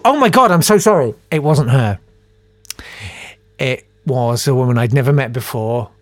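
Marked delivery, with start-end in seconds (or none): 0.0-1.1 higher, louder, horrified apology; re-enacted mortified outburst; panicked apology. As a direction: apologise in sudden alarm. Instant apology after wrong victim.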